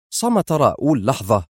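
ordinary delivery

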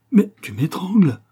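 high, loud acted alarm; alarmed protest. A narrator's reading. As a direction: cry out as if being choked. Old woman being strangled protests. (protestation affolée, aiguë et forte)

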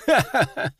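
high, derisive ha-ha-ha; mocking laugh. Commander laughs at the demonologist's claim, mocking laugh. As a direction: laugh scornfully in character.

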